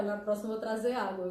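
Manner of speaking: normal talking voice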